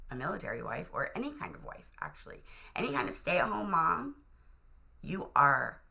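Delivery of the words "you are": normal talking voice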